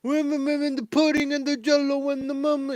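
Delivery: put-on high, rambling old comedian voice; Bill Cosby impression. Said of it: "Sounds like Bill Cosby".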